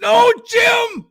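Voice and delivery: high, excited broadcaster shout; hammed-up color commentator yelling his partner's name; over-the-top Tony Romo yell; Tony Romo impression. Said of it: "I'll do my best Tony Romo impression".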